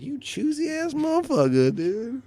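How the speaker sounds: high-pitched comic indignation; high, mock-offended outburst; mock outraged insult. Acted insult, first take.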